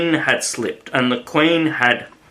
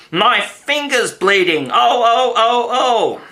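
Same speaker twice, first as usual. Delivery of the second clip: much higher, louder shrieking like a steam whistle; screaming; 10 semitones higher, 4 dB louder than usual. A narrator's reading. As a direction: scream it out in panic. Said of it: "Her screams were so exactly like".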